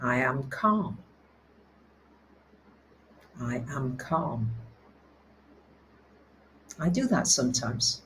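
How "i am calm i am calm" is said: soft, low and slow self-soothing; hushed lower mantra of calm; calm affirmation. Shown as a demonstration. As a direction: say it quietly to yourself, settling down. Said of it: "So you might want to say to yourself".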